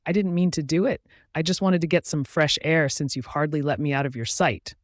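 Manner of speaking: usual speaking voice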